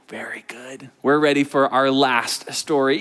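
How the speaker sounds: ordinary talk, nothing marked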